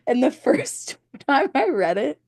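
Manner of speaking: words broken by giggles; can't hold back the laugh; giggly, silly mood; laughing through it. Real, not acted. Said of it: "I'm such a silly mood today".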